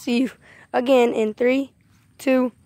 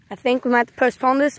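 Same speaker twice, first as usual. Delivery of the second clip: normal talking voice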